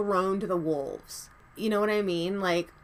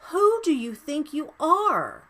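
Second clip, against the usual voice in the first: higher, indignant "Who" stressed, then falling; real indignation, rising; outraged rant; 7 semitones higher, 4 dB louder than usual. Real, not acted. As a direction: challenge him head on. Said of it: "Sorry, rant over".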